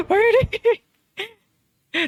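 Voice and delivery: loud words broken by laughter; laughing. Real, not acted. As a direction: laugh through the question. Laughing while under attack.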